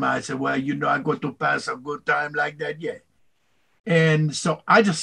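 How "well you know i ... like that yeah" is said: put-on relaxed Louisiana Cajun voice of an old tugboat captain; Cajun accent. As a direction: chat like a Cajun riverman. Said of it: "And so I said to him".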